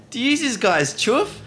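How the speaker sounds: high, slow mock old lady; squeaky bogan old lady. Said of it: "bogan old lady came up to us and said".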